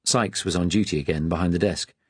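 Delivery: plain reading voice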